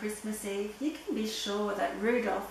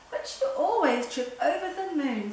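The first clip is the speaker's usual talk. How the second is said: higher-pitched squabbling retort; quarrelling; 5 semitones higher, 4 dB louder than usual. A narrator's reading. Dancer bickering with Dasher over who leads, quarrelsome. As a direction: argue back in a raised, pitched-up voice. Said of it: "kicking a fallen star".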